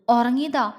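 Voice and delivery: usual speaking voice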